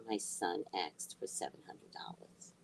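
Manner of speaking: hushed, breathy reveal; dramatic whisper. Real, not acted. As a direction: whisper it like a shameful secret. Reveals the shocking request.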